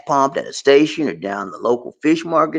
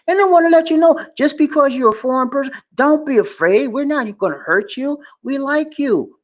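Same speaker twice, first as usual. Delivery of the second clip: sweetly reassuring; 13 semitones higher than usual. Retold or imitated.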